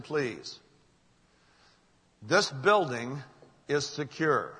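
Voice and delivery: measured, emphatic public-address announcement; building announcement. Retold or imitated. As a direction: announce it firmly to a whole building, key phrases stretched. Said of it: "we hear this announcement".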